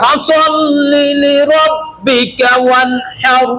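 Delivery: slow chanted tajweed recitation; Quran recitation. Shown as a demonstration. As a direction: recite slowly with tajweed, holding the vowels. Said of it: "আরেকবার বলেন". (ধীর তাজবীদসহ তিলাওয়াত)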